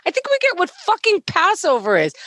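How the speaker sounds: high, fed-up mock whine; exasperated kid. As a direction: whine it like a bored kid who has heard it all.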